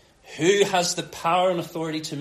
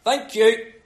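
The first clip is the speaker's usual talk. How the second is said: offhand telling-off; 5 semitones higher, 7 dB louder, much slower than usual. Acted. Jesus half-asleep in the boat hushing attackers, brisk loud order. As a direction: call out sharply, then dismiss them. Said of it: "and then he just says".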